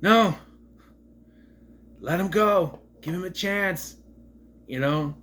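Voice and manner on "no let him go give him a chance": high, loud, earnest mock plea; Goku impression. Goku stopping Krillin from killing Vegeta, mock earnest plea. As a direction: play Goku pleading to spare Vegeta. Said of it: "Goku said".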